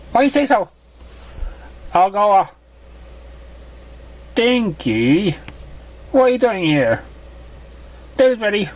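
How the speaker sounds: a bright little filly voice, pitched well up; high filly voice. Acted. Curious filly questioning the stranger in a high voice. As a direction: play the curious young filly.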